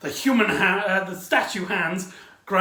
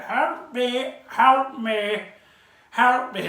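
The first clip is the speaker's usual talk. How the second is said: mock plea for help; 5 semitones higher, 3 dB louder, much slower than usual. Imitates trapped old man calling.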